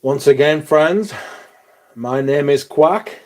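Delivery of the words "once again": normal talking voice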